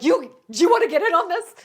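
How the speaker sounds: a mock-aggressive shout across the room; loud challenge. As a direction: confront the onlookers loudly. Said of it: "I was like".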